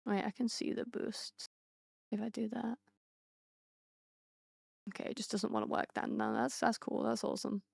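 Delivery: quiet distracted muttering off-mic; muttering to herself. Real, not acted. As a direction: mumble while checking something on screen. Checking the boosts off-mic.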